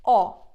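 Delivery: loud round "O" as in corner; Turkish o demo. Shown as a demonstration. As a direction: stress a pure "O". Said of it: "As in corner".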